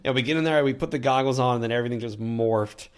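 ordinary talk, nothing marked

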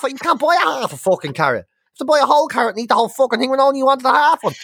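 high, heated venting; fed-up complaint; exasperated rant. Real, not acted. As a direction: rant about cooking for one. Ranting about cooking alone.

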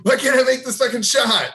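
high-pitched hammed-up whine of exasperation; mock frustration. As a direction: wail it like a kid missing every shot. Kid misses the pretend shot.

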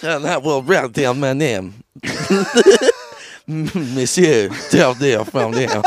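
hammed-up French with laughter between; French accent. Frenchman writing down his name, thick mock French accent. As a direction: play a ceremonious Frenchman, drawing out the name. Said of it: "He's French".

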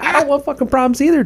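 high, loud, exasperated protest; retold yell. Drunk guy's friend answers the bartender, high and yelling. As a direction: imitate yelling back under pressure. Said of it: "I'm yelling at my friend".

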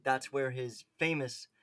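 ordinary talk, nothing marked

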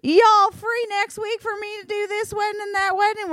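very high-pitched squeal of a Southern request; excited girls' voices. Acted. Other girls asking to book a wedding, very high put-on voice. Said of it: "the other girls are like".

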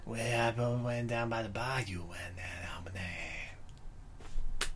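put-on slow, whispery mumbled Cajun accent; Seagal's Cajun accent. Host mocking Seagal's Cajun mumble, slow and whispered. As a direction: murmur it like Seagal doing Cajun. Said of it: "Steven Seagal with a Cajun accent?".

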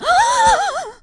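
sharp high loud mock gasp; huge shocked gasp. Mom acting out the librarian's horror, a big loud gasp. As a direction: gasp as if someone lost an arm. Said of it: "that was her reaction".

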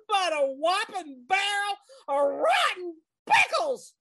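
loud furious Southern rant; angry rant. Acted. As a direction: shout her fury at the whole clan. Kitty rants about rival niece.

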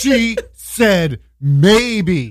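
very slow, high, swooning gush; giddy mock boast. Mimicking a smitten friend's endless bragging, giddy and drawn-out. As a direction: stretch every word in mock rapture.

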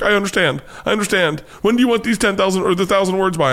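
high-pitched mimicked teenage girl; imitated daughter's voice. Daughter's eager reply voiced in story.

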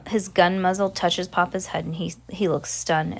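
ordinary delivery

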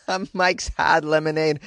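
put-on amused Boston accent on "Hard"; relishing the Boston "Hard". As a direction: say "A Mike's Hard Lemonade" like a Bostonian, dropping the R. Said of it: "doing it in a Boston accent".

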